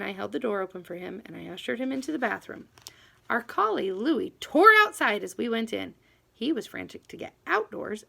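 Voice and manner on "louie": ordinary delivery, nothing marked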